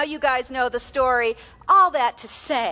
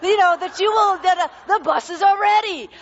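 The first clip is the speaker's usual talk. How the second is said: put-on loud, higher proud kid voice; confident boy greeting; 3 semitones higher, 5 dB louder than usual. Son greeting Billy Graham, loud and proudly confident. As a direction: announce yourself like a proud little boy. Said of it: "my son marches into the room and he goes".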